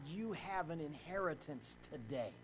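ordinary talk

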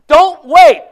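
loud, high cry of don't wait; shouted plea. Acted. Preacher shouts a warning to the lost, loud and high. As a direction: call it out like an alarm.

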